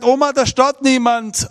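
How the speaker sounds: put-on high, loud boy's reply; boy tells grandma nobody is there; boy answering. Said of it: "Dann habe ich gesagt".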